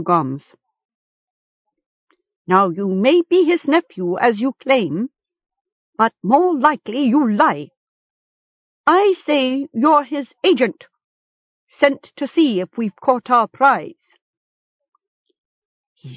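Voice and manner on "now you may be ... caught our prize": higher-pitched, pressing chieftain; accusing captor. A narrator's reading. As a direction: accuse the captive to his face.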